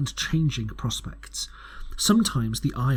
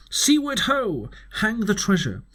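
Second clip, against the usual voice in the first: higher, eager sea call; rousing cry; 8 semitones higher than usual. A narrator's reading. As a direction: cry it out like a squire mad for the sea. Squire's rousing cry in letter.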